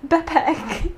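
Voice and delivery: put-on high child-like 'Backpack' without the first k; child's pronunciation. Shown as a demonstration. Showing how a child says it, high and child-like. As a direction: say ba-pack like a small child. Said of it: "like, a child would say backpack".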